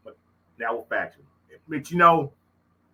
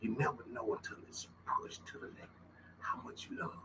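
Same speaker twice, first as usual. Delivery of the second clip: whisper; 17 dB quieter than usual. Real, not acted. Emotional closing reflection.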